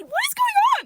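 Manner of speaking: high, loud fed-up demand; exasperated outburst. Retold or imitated. Confronting her date over the surprise dinner, loud and fed up. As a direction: burst out at him.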